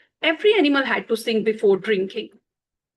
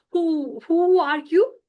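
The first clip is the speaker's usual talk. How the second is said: trembling; 4 semitones higher than usual. Acted. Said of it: "the trembling fo-, uh, squirrel said".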